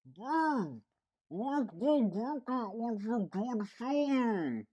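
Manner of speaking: high, muffled mock boyfriend voice. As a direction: talk as if from under a belly. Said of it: "I gotta do a muffled voice".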